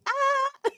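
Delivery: sudden high-pitched squeal of glee; a shrill gleeful shriek. Real, not acted. Mocking Buddy with a high gleeful squeal.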